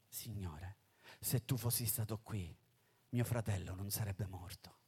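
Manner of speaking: voce bassa e morbida, più piano, come in lacrime trattenute; supplica sommessa. Acted. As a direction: implora il Signore a mezza voce. Maria ai piedi di Gesù. (Maria ai piedi di Gesù, supplica sommessa)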